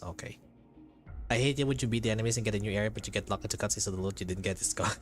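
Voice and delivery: ordinary delivery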